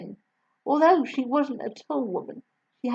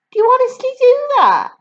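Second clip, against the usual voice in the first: put-on much higher, louder child's voice; little girl voice; 12 dB louder than usual. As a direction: answer like an eager small girl. Matilda voiced.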